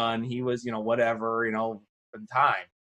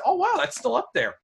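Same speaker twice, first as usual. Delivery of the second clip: high amazed exclamation; surprised; 14 semitones higher than usual. Retold or imitated. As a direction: play the old student stunned to find it there. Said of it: "he said".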